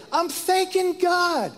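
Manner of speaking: high, drawn-out elated exclamation; elated cry. Retold or imitated. Retelling a wild dance of thanks to God, elated cry. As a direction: sing it out high and long, exultant. Retelling own excited celebration.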